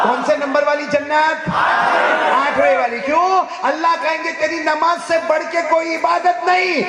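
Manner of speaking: high, fervent exhortation; preaching at full force. Acted. Preacher promising the highest paradise for prayer, full force.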